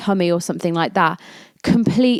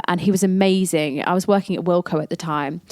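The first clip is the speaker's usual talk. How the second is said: ordinary talk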